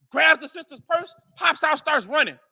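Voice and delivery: usual speaking voice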